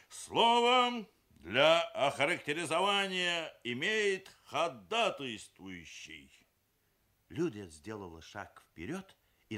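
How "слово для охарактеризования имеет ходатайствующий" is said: high, loud, slow chairman's formula; firmer official announcement. A narrator's reading. Papa chairing the family meeting, newly firm. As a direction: announce it ponderously and firmly. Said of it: "продолжал уже более твёрдо".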